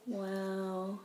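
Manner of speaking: normal talking voice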